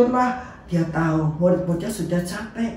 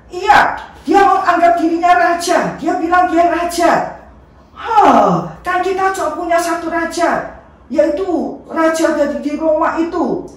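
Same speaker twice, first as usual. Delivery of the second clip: much higher, loud indignant yelling; angry accusing shout; 10 semitones higher, 5 dB louder than usual. Acted. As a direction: accuse him at full voice.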